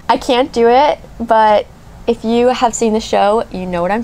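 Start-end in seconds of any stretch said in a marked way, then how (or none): none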